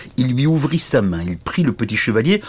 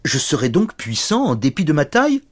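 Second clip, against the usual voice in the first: higher, mildly amazed question; a touch of disbelief, pitched up; astonished little knight; 7 semitones higher than usual. Acted. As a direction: wonder at your own power. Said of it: "Le petit chevalier fut étonné".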